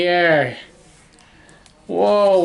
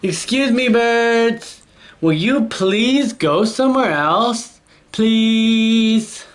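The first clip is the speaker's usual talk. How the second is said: high, beseeching request; politely pleading elephant; 6 semitones higher, slower than usual. Acted. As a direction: ask nicely, stretching the final please. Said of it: "I will try asking".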